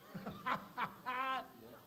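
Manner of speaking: high, bubbling laugh; amused high laughter. Acted. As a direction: burst out laughing, high-pitched. Amused at a companion's remark.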